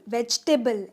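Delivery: "वेजिटेबल" as vej-te-bal, falling at the end; three-syllable वेजिटेबल. Shown as a demonstration. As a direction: leave out the middle i in "वेजिटेबल". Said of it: "वेजिटेबल नहीं". ("वेजिटेबल" को "वेज-टेबल" जैसा बोलें)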